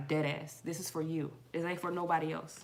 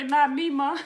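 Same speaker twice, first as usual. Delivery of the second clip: imitating her son; 6 semitones higher, 8 dB louder, slower than usual. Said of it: "My baby say".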